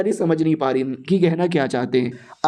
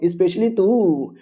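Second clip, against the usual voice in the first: especially तू stretched out very slowly; single her out with a long, drawling तू; drawn-out teasing; much slower than usual. A narrator's reading. Brothel madam picking out one girl, slow teasing drawl.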